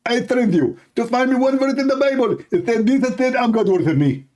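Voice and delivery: high, fast, taunting challenger voice. Retold or imitated.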